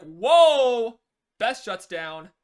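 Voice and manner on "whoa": loud, much higher startled cry; startled whoa. Retold or imitated. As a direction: jolt back in shock. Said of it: "Beth is like".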